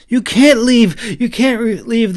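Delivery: high loud desperate cry; boy crying in panic; panicked cry. A narrator's reading. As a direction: beg him not to go. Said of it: "the boy cried in panic".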